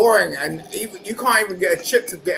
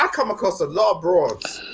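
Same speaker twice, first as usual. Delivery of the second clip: usual speaking voice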